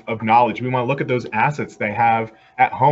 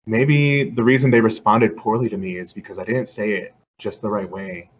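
ordinary talk

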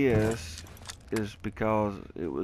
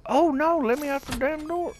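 high, loud panicked yell; play-panic, high and loud throughout; panicked cry; 6 dB louder than usual. Pretend. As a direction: cry to be let out, pitched way up. Trapped in game, calling out.

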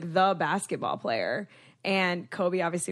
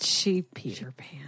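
whispered aside; 3 semitones lower, 4 dB quieter, slower than usual. Real, not acted. Joking aside.

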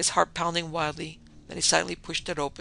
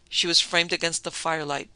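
usual speaking voice

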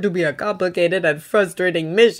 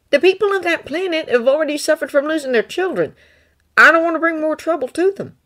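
put-on higher, earnest young Jedi voice; Thonne's voice; 10 semitones higher than usual. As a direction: lift the voice up for Thonne, sincere and pleading.

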